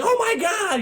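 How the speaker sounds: high-pitched mock panic; freaking out. Driver freaks out at a near miss, mock panicked shriek. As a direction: squeal it like a man losing it. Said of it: "He freaks the fuck out".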